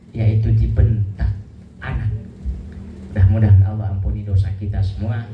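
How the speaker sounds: low, earnest preaching voice; solemn sermon. Acted. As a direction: press the guilt home gravely. Solemn reproach to the congregation. (ceramah khidmat bernada rendah)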